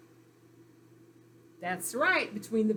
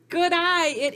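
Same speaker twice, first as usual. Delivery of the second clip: excited praise; 7 semitones higher, 7 dB louder than usual. Real, not acted. Praising a student's answer.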